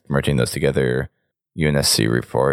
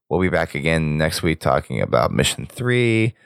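ordinary delivery

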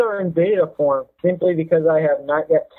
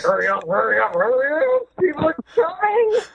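rising, higher, frantic urging; panicked urging; 9 semitones higher than usual. Retold or imitated. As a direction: hurry up, panicking. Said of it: "you're like".

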